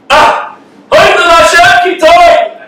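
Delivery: overjoyed new parent exclaiming; joyful parent cry. Retold or imitated. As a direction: cry out thanks over the newborn. Parents at baby's first cry.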